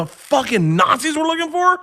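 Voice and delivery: high, squeaky disbelief; incredulous. Retold or imitated. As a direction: play the stunned tourist hearing it for the first time. Learns the Nazis hunted her.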